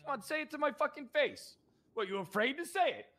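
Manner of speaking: high-pitched confrontational yell; angry yelling. Pretend. Sheriff dares the restaurant staff to insult him, angry yelling. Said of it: "you come in here yelling".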